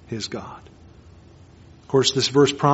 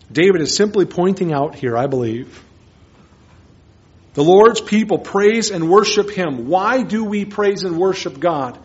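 usual speaking voice

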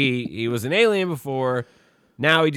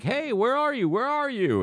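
calling out; 13 semitones higher than usual. Retold or imitated. Boyfriend calling through house.

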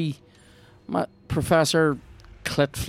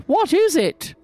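mock character voice; 5 dB louder, slower than usual. Echoing the game character mockingly.

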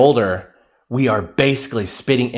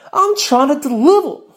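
much higher, louder mock plea; high playful plea; 4 dB louder than usual. Host begs listeners to appreciate comedy, squeaky. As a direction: plead it in a squeaky voice. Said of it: "playful plea to listeners".

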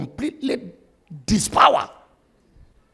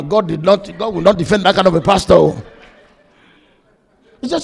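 ordinary talk, nothing marked

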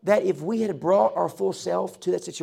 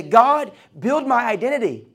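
loud, higher-pitched cry out to God; raised-voice mock prayer; acted plea; 6 semitones higher, 8 dB louder than usual. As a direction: call out to God, punching the first word.

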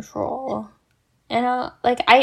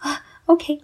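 tired relief; 9 semitones higher, faster than usual. Retold or imitated. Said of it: "I kinda just go like".

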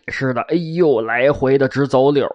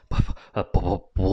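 plain reading voice

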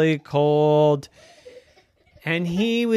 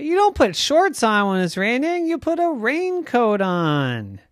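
higher, louder, fast mommy scolding; 8 semitones higher, 4 dB louder than usual. Acted. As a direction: scold like an exasperated mom. Mommy correcting Bob.

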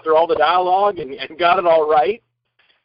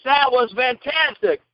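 higher, slow admiring praise; director's praise; 7 semitones higher, slower than usual. Retold or imitated. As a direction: savour the compliment.

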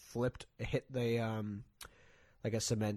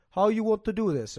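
mock Russian driver; 9 semitones higher, 10 dB louder than usual. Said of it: "he's like".

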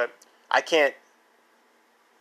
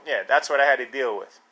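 normal talking voice

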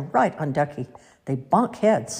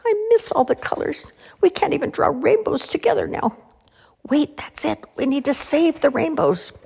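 high little character voice, wistful then eager; crayon voices; 11 semitones higher than usual. A narrator's reading. Crayons missing the colors hit on a plan, high-pitched voice. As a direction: pipe up high, moving from longing to a bright idea.

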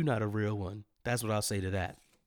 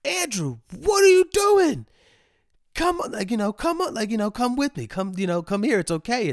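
much higher, coaxing neighbor voice; kindly neighbor; 9 semitones higher than usual. Retold or imitated. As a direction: coax a scared kid home like the neighbor did. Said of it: "and he's like".

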